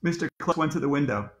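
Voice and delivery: plain reading voice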